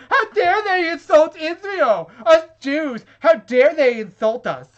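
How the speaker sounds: high, loud, slow mock outrage; mocking pissed-off commenter. Mocking a commenter's outrage, high and loud. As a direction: ham up an offended commenter's indignation.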